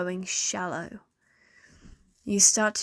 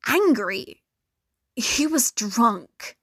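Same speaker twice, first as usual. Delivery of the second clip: ordinary delivery, nothing marked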